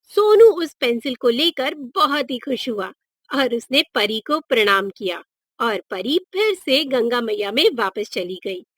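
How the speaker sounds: ordinary delivery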